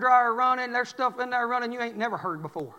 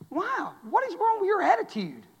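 higher-pitched mock wife; wife's retort; 8 semitones higher than usual. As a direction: lightly play the startled wife. Wife voiced in story.

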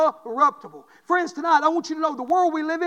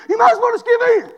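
higher, louder and faster, hammering home the warning; fervent preacher shout; 7 semitones higher, 6 dB louder, faster than usual. Acted. Preacher warning the congregation not to give in, shouting. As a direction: belt it out like a revival preacher.

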